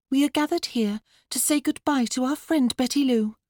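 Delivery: put-on high, girlish ceremonial tone; best funeral voice. A narrator's reading. Daughter addressing mourners for Betty Lou, funeral voice. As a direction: officiate like an earnest child. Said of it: "in her best funeral voice".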